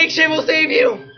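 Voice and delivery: high, loud panicked yell; frantic shouted reassurance; urgent shout. Real, not acted. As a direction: yell advice over the chaos. Shouting advice during chase.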